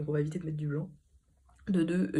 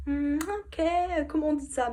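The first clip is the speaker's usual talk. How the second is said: imitating herself revising; 9 semitones higher, slower than usual. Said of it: "je vais réviser dimanche en mode".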